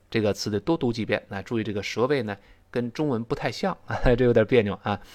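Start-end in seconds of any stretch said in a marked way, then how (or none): none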